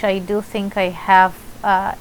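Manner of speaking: ordinary talk, nothing marked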